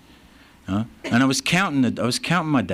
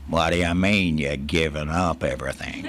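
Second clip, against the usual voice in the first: lower-pitched mock Texan drawl; 5 semitones lower than usual. Texan friend questions him about quitting, in a low Texan drawl. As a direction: put on a thick Texan accent. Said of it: "he's got that Texan drawl".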